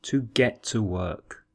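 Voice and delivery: "To get dressed" said slowly and evenly; slow teacher model of "To get dressed"; slow model phrase. Shown as a demonstration.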